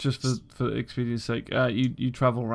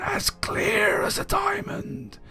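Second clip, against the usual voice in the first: high, slow, breathy spirit whisper; haunt them from inside their head; ghostly whispering voice; slower than usual. Acted. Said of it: "the riddle comes inside your head".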